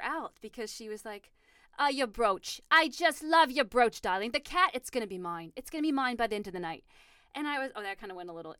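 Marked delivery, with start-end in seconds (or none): 1.8-6.8 imitating a friend's prim Boston accent; mock-posh Boston-English granny accent. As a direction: put on a proper Boston accent, sliding toward English. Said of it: "she just had a really good accent".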